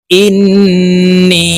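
ইন্নী with a long hummed n and drawn-out ee; ghunnah and stretch. Shown as a demonstration. Teacher repeating after the rule, held n and long ee in ইন্নী. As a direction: chant ইন্নী slowly, holding n then ee. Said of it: "এখানে এক আলিফ টান হবে".

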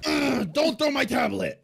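angry shout. Acted. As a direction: snap at the kid for throwing it. Dad scolds son.

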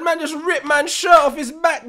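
loud, high, excited telling; excited shout. Real, not acted. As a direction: shout it out in disbelief. Excited retelling of shirt grab.

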